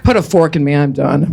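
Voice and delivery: low, spent end-of-day voice; sloth impression. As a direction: drop low as if worn out after a long day. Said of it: "That's sloth at the end of a long day".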